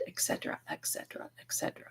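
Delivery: very soft, voiceless repetitions; whispered aside. Real, not acted. Rattling off devices to viewers, whispered aside. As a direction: whisper it, trailing away.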